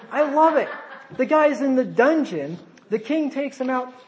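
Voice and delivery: gleeful preacher voice; delighted. Real, not acted. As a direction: relish the irony with a laugh.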